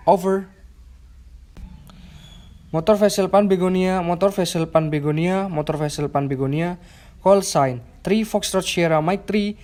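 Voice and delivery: over a radio. Shown as a demonstration. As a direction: answer the call clearly over radio. Radio call demo video.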